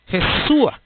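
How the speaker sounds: mock the odd sound of "Jesúa" with a high rising A; Jesúa with final A. Shown as a demonstration. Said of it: "No se oiga con A".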